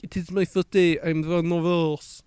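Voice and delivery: light mock French accent, slow and careful; a hint of French accent, read out slowly; French waiter. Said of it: "That's not how he sounded".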